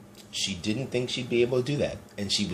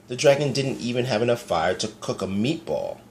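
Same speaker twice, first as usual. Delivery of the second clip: ordinary delivery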